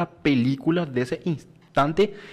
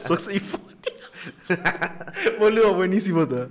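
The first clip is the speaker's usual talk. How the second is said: laughing; faster than usual. Real, not acted. Repeating the flub while laughing.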